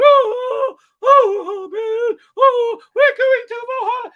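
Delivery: very high, loud mock-heroic cry; hammed-up falsetto wailing. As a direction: wail it out in piercing falsetto. Acts warriors sailing off to Valhalla.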